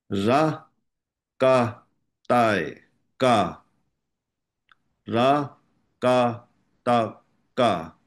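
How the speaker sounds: measured syllables with gaps between them; slow spelling drill. Shown as a demonstration. Teacher leading students in Tibetan spelling, slow drill. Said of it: "我们慢慢先拼读的方式念一下".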